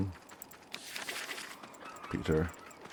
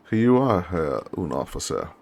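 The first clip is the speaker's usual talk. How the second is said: louder officer's greeting; lightly voiced lieutenant; 7 dB louder than usual. Lieutenant greets his new junior officer, lightly acted authority. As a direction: lightly play a superior receiving his junior.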